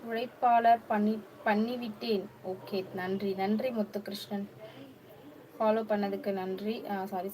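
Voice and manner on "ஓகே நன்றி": ordinary talk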